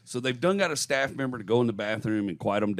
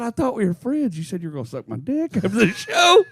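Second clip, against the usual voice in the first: high-pitched, put-on indignation; hammed-up wounded complaint, pitched up; mock hurt protest; 9 semitones higher than usual. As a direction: plead as if betrayed by a friend. Acting comic's wounded protest to heckler.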